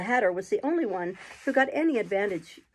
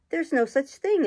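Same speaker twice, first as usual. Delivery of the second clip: raised, indignant outburst; very angry; 7 semitones higher, 4 dB louder than usual. A narrator's reading. Alice cross at the treacle well, angrily. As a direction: snap it out in anger. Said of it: "Alice was beginning very angrily".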